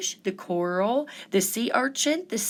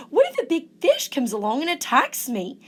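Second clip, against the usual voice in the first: higher, a little anxious inner voice; lightly voiced worried crab; worried crab thinking aloud; 6 semitones higher, 3 dB louder, faster than usual. A narrator's reading. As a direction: fret about big fish. Hermit Crab frets alone at sea.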